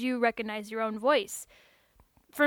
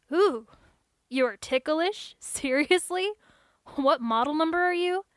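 higher, louder surprised questions; surprised technician; 5 semitones higher, 8 dB louder than usual. Acted. Technician surprised the android is ticklish, higher questions. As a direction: tech amazed she is ticklish.

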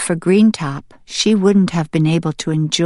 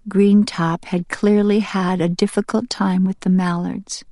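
ordinary delivery, nothing marked

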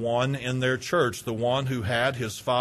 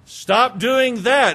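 louder, slower, higher insistent order; annoyed slow command; 7 semitones higher, 6 dB louder, much slower than usual. Acted. An irritated person insisting someone stop a habit, slow loud command. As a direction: spell it out firmly, each word hammered. Scolder repeats the demand, annoyed.

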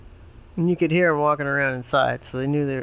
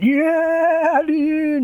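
frat bro; 6 dB louder than usual. Acted. Pig one voiced in story.

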